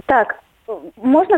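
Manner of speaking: ordinary talk, nothing marked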